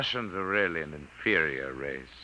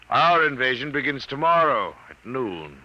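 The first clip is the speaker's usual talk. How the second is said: higher and louder, proclaiming victory; triumphant proclamation; 8 semitones higher, 6 dB louder than usual. Acted. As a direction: declare the conquest with relish. Alien announces invasion.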